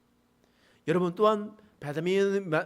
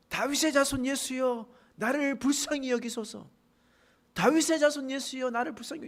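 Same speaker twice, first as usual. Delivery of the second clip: beggar crying out; 7 semitones higher than usual. Retold or imitated. Blind beggar calling to passing Jesus, high pleading cry. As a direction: call out to Jesus over the crowd, pitched high. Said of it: "이렇게 막 고래고래 소리".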